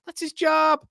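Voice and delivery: very high, loud mock exclamation; put-on squawk. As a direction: squawk it out far above your own voice. Put-on squawked catchphrase reply to viewer.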